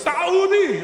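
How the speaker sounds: Saudi yelled very high as "Sa-OO-di"; hiccuping Saudi. Retold or imitated. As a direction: shriek Saudi with a catch and long "oo". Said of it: "I thought he hiccuped or something".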